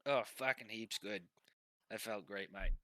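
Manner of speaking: put-on broad Australian accent; laid-back Australian mate; Pilfer. Pilfer praises the massage, Australian accent. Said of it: "it's hard to do fucking accents back to back".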